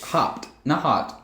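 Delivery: "Hopped" said slowly and clearly against "hot"; correcting hopped vs hot. Shown as a demonstration. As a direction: stress "Hopped" then "hot", x0.6 pace. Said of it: "I thought you said hot".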